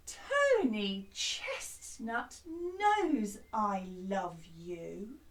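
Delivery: slow, spaced-out teaching delivery; slow spoken lyrics. Shown as a demonstration. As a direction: say the words slowly, without singing. Teaching the song words slowly.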